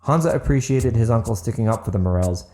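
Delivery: ordinary delivery, nothing marked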